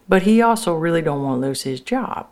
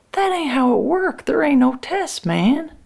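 high exasperated protest; 11 semitones higher than usual. Acted. Frankie protesting to the boss, high and exasperated. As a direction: voice jumps way up in disbelief.

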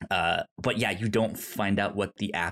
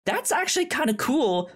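impressed quote; 11 semitones higher than usual. Retold or imitated. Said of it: "she goes".

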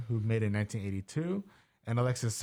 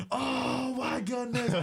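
high, slow, near-crying shriek of joy; ecstatic disbelief; 12 semitones higher, much slower than usual. Retold or imitated. Cinema crowd around a phone, ecstatic high shriek. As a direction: cry out as the kick goes through. Crowd watching winning kick.

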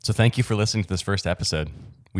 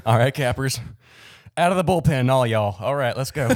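sudden hammed-up Southern twang, higher and louder; rally the listeners like a Southern coach; loud, high Southern pep-rally call; Southern accent; 7 semitones higher, 4 dB louder than usual. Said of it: "I don't know why I got Southern".

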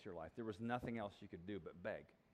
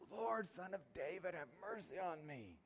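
a hint of a yell, no louder than usual; yelled all the louder; 6 semitones higher, 4 dB quieter than usual. Beggar calling to Jesus again, a mild mock yell. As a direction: lift the pitch a little but keep the volume down. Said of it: "it says he yelled all the louder".

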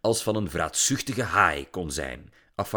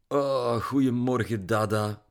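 drawn-out, sleepy groaned greeting; slow, listless morning moan; sluggish groan; 3 semitones higher, slower than usual. A narrator's reading. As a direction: groan it lazily, barely up yet. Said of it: "kreunde Lily loom toen ze binnenkwam".

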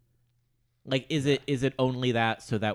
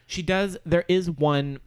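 excited; 9 semitones higher, 8 dB louder, slower than usual. Real, not acted. Excitedly recalling a favorite moment.

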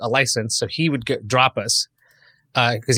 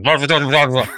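garbled announcement; 4 dB louder than usual. Retold or imitated. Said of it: "versus you're going".

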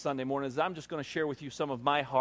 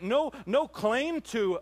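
slow emphatic; 8 semitones higher, much slower than usual. Real, not acted. Building preaching, slow.

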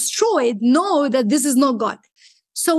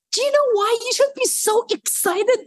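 high-pitched, retold excited question; eager excitement; 9 semitones higher than usual. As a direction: pitch it high, bursting to explain.

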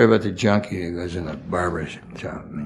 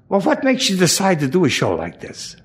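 much higher, curious woman's voice; directress; 10 semitones higher than usual. Retold or imitated. Directress asks why he took the show, high-pitched. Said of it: "She said".

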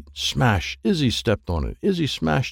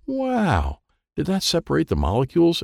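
amazed; 6 semitones higher than usual. Acted. Izzy amazed by the spray.

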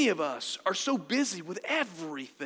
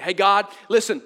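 loud, higher-pitched sheepish excuse to God; mock bargaining prayer; 4 semitones higher, 9 dB louder than usual. Sinner asking God for pardon once more, mock bargaining. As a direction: haggle loudly, stumbling over the uhs. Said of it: "Many of us are good at praying this".

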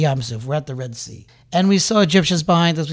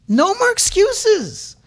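"No more excuses" cried out much higher and slow; high shout; 13 semitones higher, 4 dB louder, much slower than usual. Real, not acted. Preacher cries out to the congregation, a high slow shout. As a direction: cry "No more excuses" at the top of the voice.

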